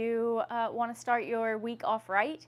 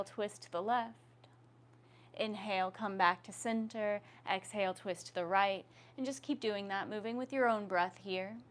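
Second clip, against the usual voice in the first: yoga instruction. Acted. Leads yoga neck movements.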